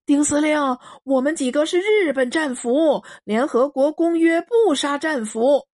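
提高音调大声求饶; 急切高声争辩; 日本战俘喊. Acted. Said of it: "那个叫李石坎的日本人喊". (日本战俘高声求饶)

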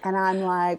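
ordinary talk

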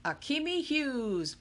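lightly voiced emcee; announcing names. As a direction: call the winner like on a stage. Calling winners to stage.

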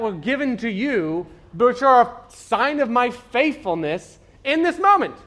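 high, loud, slowed rebuke, God listing every miracle; God's exasperated rant. Retold or imitated. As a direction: preach it as God scolding his doubting people. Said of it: "It was like".

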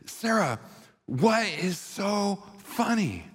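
husband shouting at his laughing wife, stunned; shocked outburst. Retold or imitated. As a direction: blurt it out in total shock. Shocked husband finally blurts out.

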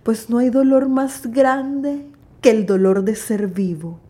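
loud, solemn verse recital with a whispered line; grand poetry declamation. Acted. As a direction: declaim it like a stage poet. Poem teaser before podcast intro.

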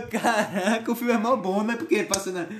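ordinary delivery

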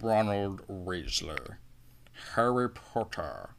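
very slow, drawn-out Rickman drawl; Alan Rickman voice. Retold or imitated. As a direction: savour each name like Snape. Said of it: "Alan Rickman in anything".

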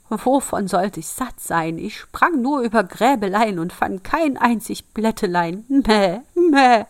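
high, loud protesting goat; goat's indignant singsong complaint; bleating goat. Acted.

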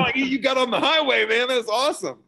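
high, loud, gushing excitement; thrilled bystander. Retold or imitated. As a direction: burst out like it's the best news ever. Acting out someone's thrilled reaction.

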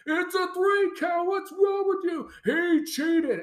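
high-pitched mock shout at the ref; hammed-up wrestler yelling, high and strained; mock screaming. Wrestler screaming at the referee over the count, high mock shout.

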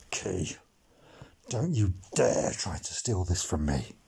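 quiet threat. Acted. Boy warns rival Key off the captaincy, quietly. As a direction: menace him under your breath.

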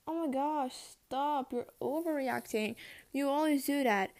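mock dismissive friends.

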